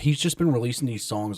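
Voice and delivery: usual speaking voice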